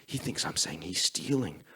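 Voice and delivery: whispered, worried thought; realizing Jerry is offended, whispered worry; panicked inner whisper. Retold or imitated.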